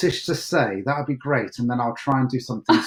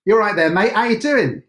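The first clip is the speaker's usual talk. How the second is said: louder, higher East London greeting; East London accent; 5 semitones higher, 5 dB louder than usual. Shown as a demonstration. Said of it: "East London accent".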